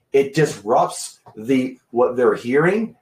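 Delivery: ordinary delivery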